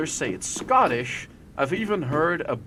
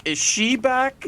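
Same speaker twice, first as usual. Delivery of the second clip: slower, higher mock-worried "Is she back?"; mock dramatic; 10 semitones higher, 4 dB louder, slower than usual. Shown as a demonstration. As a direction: ham up the worry, drawn out.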